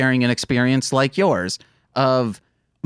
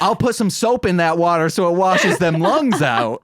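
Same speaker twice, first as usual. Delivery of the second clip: hammed-up country dad scolding; mock stern dad; 5 semitones higher than usual. Son mocking his dad about cursing, mock scolding dad. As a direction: bark it like an old-fashioned father.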